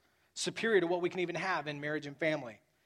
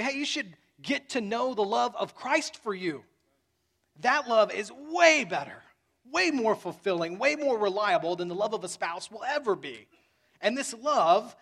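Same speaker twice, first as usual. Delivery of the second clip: preaching at full force; 4 semitones higher, 5 dB louder than usual. Acted. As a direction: lift the voice and press each "way". Preacher raises voice in emphasis.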